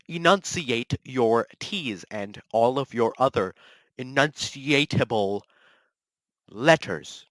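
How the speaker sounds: "Enunciate" and "enunciatable" slowly spelled out syllable by syllable; overenunciated. Shown as a demonstration. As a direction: hit every consonant in "enunciatable letters", slowly.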